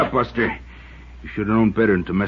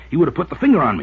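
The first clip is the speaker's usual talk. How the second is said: louder, higher frantic defence; desperate to be believed; a loud, high plea of self-defence; agitated outburst; 6 semitones higher, 5 dB louder, faster than usual. Acted. Alex frantic over the killing.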